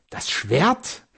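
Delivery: high, astonished questioning. A narrator's reading. Wife asked to fetch the huge sword, astonished. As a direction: echo it in amazement, pitch lifted. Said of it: "frug sie erstaunt".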